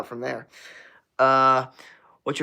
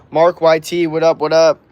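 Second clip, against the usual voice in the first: loud greeting; 3 semitones higher, 8 dB louder than usual. Real, not acted. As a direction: hype shout-out, louder and higher. Loud stream shout-out.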